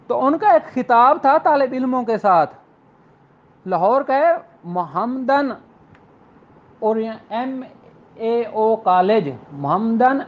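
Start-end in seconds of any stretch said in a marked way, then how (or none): none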